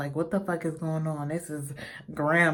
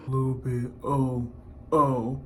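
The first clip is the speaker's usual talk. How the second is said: mock flirt; 4 dB louder than usual. Mocking someone wanting a bit.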